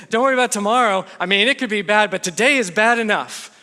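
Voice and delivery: sarcastic Jesus impression, pumped up; mock pep talk. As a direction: deliver a hopeless pep talk as if it helps. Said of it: "That's quite the pep talk".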